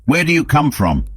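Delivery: shouting. Acted. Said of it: "The Uzbek shouted to the mad priest".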